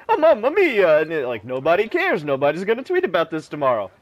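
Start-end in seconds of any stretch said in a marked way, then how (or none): 0.1-1.0 high, loud mock Italian exclamation; hammed-up Italian "mamma mia"; mock Italian accent. Mocking comedians who joke about Italians, mock Italian accent. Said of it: "and it's like".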